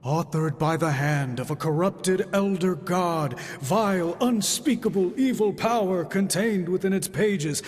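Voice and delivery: slow, hammed-up spooky narration mocking a sorcerer; drawn-out mock doom, parodying his warnings; mock ominous recital. Tony mocking Strange's dire warnings, in slow mock-ominous tones. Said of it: "just a bunch of hocus-pocus mumbo-jumbo".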